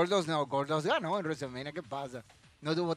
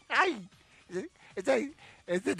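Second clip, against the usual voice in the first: normal talking voice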